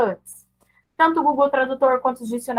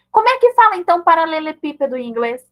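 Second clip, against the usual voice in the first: high, loud quizzing, easing down; imitated nosy questioner; 4 semitones higher, 4 dB louder than usual. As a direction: put someone on the spot, starting high. Mimics someone quizzing her English. (curioso cobrando, começa alto e agudo)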